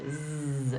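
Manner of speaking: slow buzzing "Z" with voice; z sound. Shown as a demonstration. Teacher demonstrating a voiced "Z". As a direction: stretch the "Z" into a buzz. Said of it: "teeth are kind of clenched".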